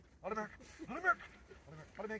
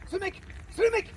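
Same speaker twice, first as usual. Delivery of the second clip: 1 sharp call; 12 semitones higher than usual. Real, not acted. Calling a dog across field.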